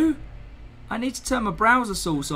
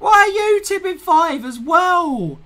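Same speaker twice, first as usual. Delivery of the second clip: excited exasperation; 8 semitones higher, 5 dB louder than usual. Real, not acted. Exasperated thanks to the donors.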